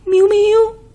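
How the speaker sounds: very high sing-song kitty call; girl calling a cat, high and sweet. Acted. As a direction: coax the cat down from the roof. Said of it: "gọi hoài".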